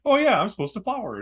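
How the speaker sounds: high, playful voice for a flower; flowers perking up. Pretend. Host voicing flowers after the rain, playful realization. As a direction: pitch leaps in mock sudden realization.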